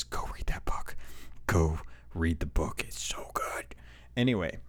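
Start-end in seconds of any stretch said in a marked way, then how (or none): 0.0-1.0 soft whispered aside to the fans; hushed plea; whispered urging. Real, not acted. Urging fans to read book. 2.9-3.7 breathless whispered praise; hushed and awed; whispered gush. Real, not acted. Gushing about the book.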